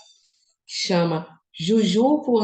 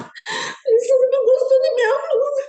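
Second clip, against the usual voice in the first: much higher, whimpering and teary, hammed-up; mock crying whine. Her past self after Alessandra dislikes her blouse, mock sobbing. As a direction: play her old self sobbing over a blouse, overdone. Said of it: "se ela tivesse falado que não pra mim há uns anos atrás".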